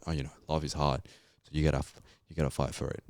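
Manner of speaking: normal talking voice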